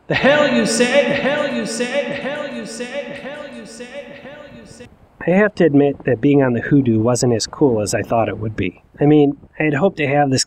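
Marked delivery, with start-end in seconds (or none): 0.1-4.9 high echo fading away to nothing; each repeat softer and farther off, like an echo; fading canyon echo. Retold or imitated. Mimicking the canyon echoing Brady's shout, high and fading. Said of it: "so loudly that it reverberated across the canyon".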